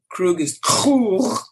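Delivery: second Krug in Klingon, guttural throaty ends; the final Krug as a rasped Klingon khrookh; Klingon pronunciation. Shown as a demonstration. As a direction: say the last Krug slowly with throat-scraped consonants. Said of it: "like that".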